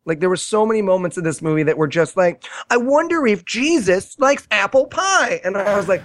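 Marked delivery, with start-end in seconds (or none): 2.7-5.4 higher, slow, naive musing; mock puerile wondering. Movie's puerile moment retold, mock childlike wonder. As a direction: muse it like a simpleton. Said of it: "So much of this movie was puerile".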